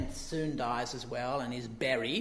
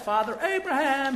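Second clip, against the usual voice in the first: higher, louder call to Abraham; 8 semitones higher, 6 dB louder than usual. Retold or imitated. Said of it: "he calls out".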